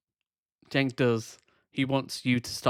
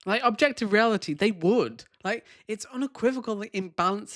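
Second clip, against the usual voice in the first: exasperated; 8 semitones higher than usual. Real, not acted. Commentator exclaims over debate.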